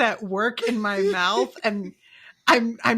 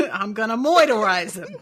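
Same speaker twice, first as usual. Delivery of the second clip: cartoon Brooklyn accent; wisecracking Brooklyn toon with a laugh; Bugs Bunny; 5 dB louder than usual. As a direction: exaggerate the Brooklyn vowels. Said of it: "Bugs Bunny tried really hard".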